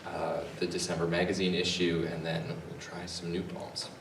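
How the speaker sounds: normal talking voice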